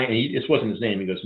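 normal talking voice

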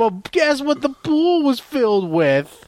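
high, excited mock riddle; put-on wacky storyteller; hammed-up suspense. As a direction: tease the punchline like an overeager comic. Hammed-up quiz in absurd story.

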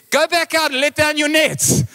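high, ringing command to go; acted high-pitched command; calling out instruction. Jesus instructing fisherman in retold story.

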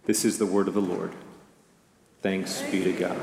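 liturgical response. Acted. Pastor ending the Gospel reading, solemn and low. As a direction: close the reading with reverence.

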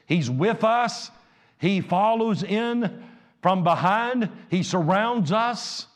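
high, loud pulpit preaching; fiery sermon delivery; full-force preaching. Acted.

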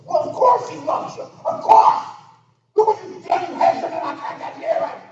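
high, sneering mock voice; mocking Satan. Preacher mocking Satan's jealous reply, high and sneering. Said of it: "He goes".

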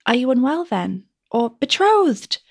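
high, worried, probing; anxious questioning. Acted. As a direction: press her anxiously.

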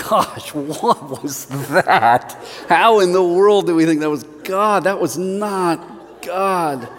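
slow, real laughter breaking through; chuckling in disbelief at himself; laughing it off, slowly. Real, not acted. Laughing at the memory.

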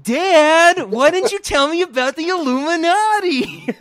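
high, loud mocking whine; mimicking Lara. Mocking Lara's question to her father, high and loud. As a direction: ham up her plea. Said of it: "I was just mimicking her".

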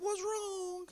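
high, soft, casual query; oblivious question. Acted. Jesus breezily asks mourners the matter, innocently high. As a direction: ask it lightly in a high, soft voice.

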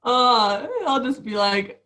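usual speaking voice

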